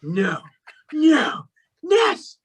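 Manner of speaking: building from no to a high, excited yes; retold screaming. Reliving the moment she guessed it, building excited cries. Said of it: "I was screaming from the top of my voice".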